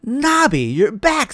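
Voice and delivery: high, happy welcome; delighted greeting. A narrator's reading. Neighbor delighted to see the dog back home. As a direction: greet him with glad relief. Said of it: "said Missus Smith".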